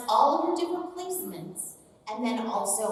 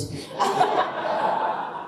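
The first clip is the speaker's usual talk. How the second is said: laugh; 5 dB louder than usual. Real, not acted. Laughing at her own voice.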